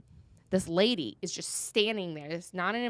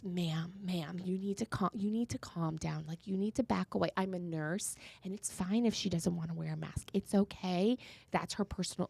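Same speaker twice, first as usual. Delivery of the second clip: calming nurse; 2 semitones lower, 9 dB quieter than usual. Retold or imitated. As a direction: quietly talk an angry stranger down. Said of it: "diffused this whole situation".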